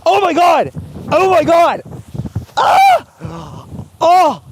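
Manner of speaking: high, loud pained shouting; screaming in pain. Real, not acted. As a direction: yell every word through the burning. Screaming under tear gas.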